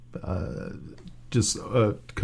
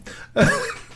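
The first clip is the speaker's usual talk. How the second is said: laugh. Real, not acted. Laughing at eat them.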